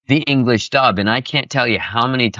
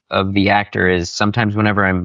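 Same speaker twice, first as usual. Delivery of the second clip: ordinary talk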